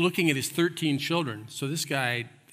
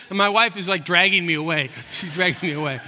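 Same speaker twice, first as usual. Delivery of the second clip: ordinary talk, nothing marked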